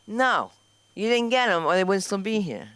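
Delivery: normal talking voice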